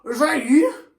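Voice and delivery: just woken, squinting at a friend; groggy. Acted. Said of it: "groggily woke".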